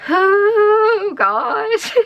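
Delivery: high, loud emotional outburst; choked up. Real, not acted. Showing the gift from her late dad's trip, choked up. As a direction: break as the feeling hits.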